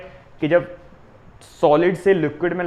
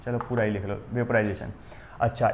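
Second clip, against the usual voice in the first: ordinary delivery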